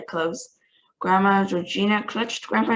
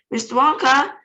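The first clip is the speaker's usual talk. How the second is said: loud, higher call; 6 semitones higher, 6 dB louder than usual. A narrator's reading. Charlie yelling to Wonka over the wind noise, loud. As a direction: call him above the roaring wind. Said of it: "he yelled above the noise".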